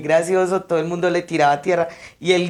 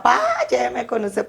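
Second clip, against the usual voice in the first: much higher mock wail to his dead father; retold horrible scream; 7 semitones higher than usual. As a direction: imitate the son's out-of-control cry. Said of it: "él gritaba".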